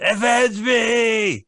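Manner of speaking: slow, high, shouted dying plea; overacted death scene. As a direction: bellow it like a dying hero. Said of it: "He's like".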